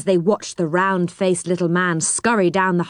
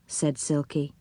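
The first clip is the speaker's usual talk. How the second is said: ordinary delivery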